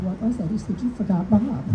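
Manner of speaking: ordinary talk, nothing marked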